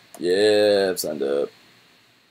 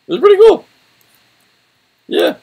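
high, loud excited burst; 9 dB louder than usual. Real, not acted. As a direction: gush with delight. Excited reaction to shop.

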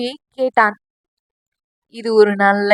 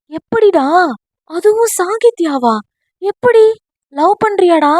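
confused; 7 semitones higher, 3 dB louder than usual. Acted. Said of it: "என்று குழப்பத்துடன் கேட்க".

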